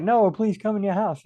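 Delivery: high mock-pleading invitation; pleading host. Host begging a guest to come in, high pleading. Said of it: "the other person's like".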